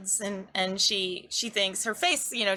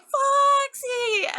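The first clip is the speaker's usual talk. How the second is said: put-on very high, drawn-out kid's squeal; delighted child; 4 dB louder than usual. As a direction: squeal the word like a thrilled little girl. Little girl delighted by fox.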